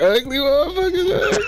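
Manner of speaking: very high comic squeal; hammed-up high outburst of dismay; mock startled disgust. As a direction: shoot the pitch right up in mock horror. Repeats acted reaction to camera.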